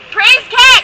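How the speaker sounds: sudden loud, high shout; shouted exclamation. Acted. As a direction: belt it out like a rallying cry. Sudden exclamation within the frog story.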